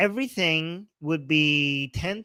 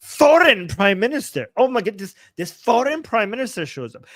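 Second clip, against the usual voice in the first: higher, louder mock outrage; mocking imitation; 6 semitones higher, 5 dB louder than usual. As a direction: parrot it back in exaggerated alarm. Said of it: "using foreign as a way to attack somebody".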